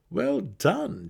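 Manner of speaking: high, loud praise; approving mercenary. A narrator's reading. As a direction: nod of approval. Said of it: "Jarlaxle commented".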